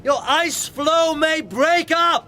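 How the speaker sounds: loud insistent hail; shouting. Acted. Captain urges the stranger aboard, loud high shout. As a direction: shout the order high across the ice.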